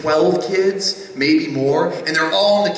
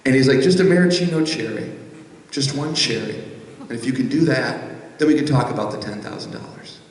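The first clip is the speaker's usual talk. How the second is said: lightly voiced Southern pitmaster; 6 semitones lower than usual. Big Daddy presents hot sauce.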